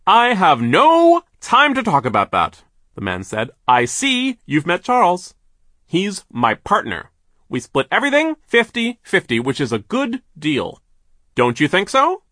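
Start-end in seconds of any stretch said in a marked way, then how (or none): 0.1-2.6 loud, high, drawn-out boss voice; big overbearing mill owner; brusque boss. A narrator's reading. 3.7-12.2 loud, high, emphatic boss voice; big pushy businessman voice; overbearing boss. A narrator's reading. Voices the mill owner.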